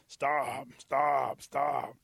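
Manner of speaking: loud, punched-out repeated order; bossy bullfrog putting a stop to it; commanding bullfrog. Acted. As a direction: croak each word out as a blunt, forceful command. Bullfrog orders the singing rabbit.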